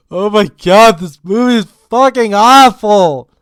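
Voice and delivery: loud, high-pitched rant; fed-up yell; exasperated outburst. Real, not acted. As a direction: explode in exasperation at how bad it is. Exasperated rant about the movie.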